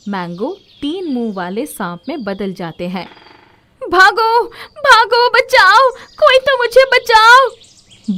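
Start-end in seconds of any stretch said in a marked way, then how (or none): 3.8-8.2 very high, very loud cries for help; terrified screaming. Acted. Komal fleeing the snake, panicked screaming for help. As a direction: shriek and run from the snake.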